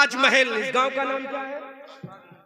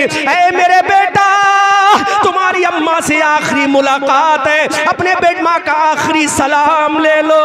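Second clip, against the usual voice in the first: fiery preaching; 5 semitones higher, 11 dB louder than usual. Acted. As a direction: preach it out to the whole hall. Preacher voices mother, full force. (ज़ोरदार तक़रीर का अंदाज़)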